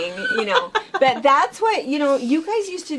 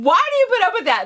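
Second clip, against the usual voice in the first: higher, louder and fast mock complaint; grumbling dad; 10 semitones higher, 7 dB louder, much faster than usual. Husband woken by the cat, complaining to his wife, fast mock grumble. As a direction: rattle it off like an annoyed husband. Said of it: "Dad's awake going".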